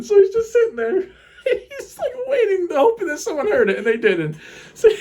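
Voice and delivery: high, wheezy words through real laughter; losing it completely while telling the story; laughing helplessly. Real, not acted. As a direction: break down laughing mid-sentence. Cracking up at own fart story.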